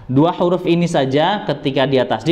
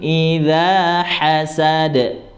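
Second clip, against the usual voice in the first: slow, drawn-out chanted recitation with long held nasal sounds; Quran recitation; 4 semitones higher, much slower than usual. Acted. Teacher reciting the verse aloud for students, slow melodic chant. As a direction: recite it like tajwid practice, each sound stretched.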